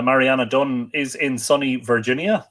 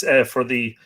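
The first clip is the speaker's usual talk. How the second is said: ordinary delivery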